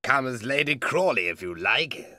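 put-on testy old suitor; impatient cry. A narrator's reading. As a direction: blurt it out impatiently. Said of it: "the old man cried impatiently".

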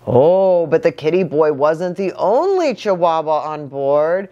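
ordinary delivery

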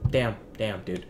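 ordinary talk

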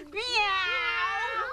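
high, drawn-out yowling meow; loud cat meow. Acted. Mother acts out the noisy cat, high meowing. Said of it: "She meowed so loud".

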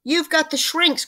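high, loud accusing cry. A narrator's reading. Mister Twit pointing at his wife like a pistol, crying out. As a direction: call it out as an accusation. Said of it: "cried Mister Twit".

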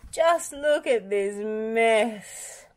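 slow, dragged-out groan. Acted. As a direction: moan it out, dismayed. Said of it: "Bob groaned".

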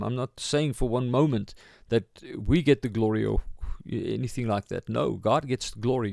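ordinary talk, nothing marked

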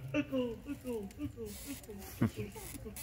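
canyon echo. Acted. Canyon answers the yell, a soft fading echo. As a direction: imitate an echo dying away. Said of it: "It said".

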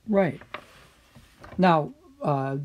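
ordinary talk

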